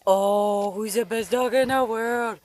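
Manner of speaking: doggy praise question. Real, not acted. Praising the dog.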